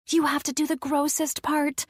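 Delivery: put-on high, excited child's voice; little girl. Addison whispering orders to Lena, excited. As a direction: giggle it like an eager little girl.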